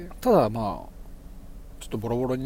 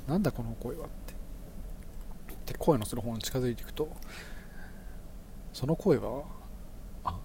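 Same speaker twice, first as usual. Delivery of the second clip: hushed creepy ghost story; 5 dB quieter than usual. Acted. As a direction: draw them in, softly and ominously.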